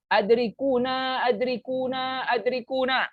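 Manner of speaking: mock plea to be rescued; cry for help. Shown as a demonstration. Teacher acting out an Arabic call for help, pretend distress. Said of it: "Tolong aku".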